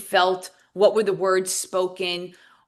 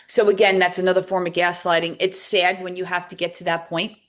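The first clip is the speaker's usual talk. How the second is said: ordinary talk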